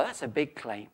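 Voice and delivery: ordinary talk, nothing marked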